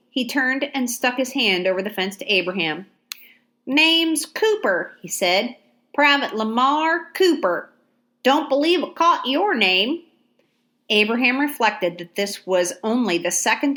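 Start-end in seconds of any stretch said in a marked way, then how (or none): 3.6-5.0 slow, higher Southern drawl, friendly; Georgia drawl. A narrator's reading. Soldier offers his hand to Abraham, slow Georgia drawl. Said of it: "a Georgia accent". 5.9-10.0 higher, polite Georgia soldier voice; friendly country introduction; Georgia drawl. A narrator's reading. Soldier introduces himself to Abraham, Georgia drawl. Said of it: "a Georgia accent".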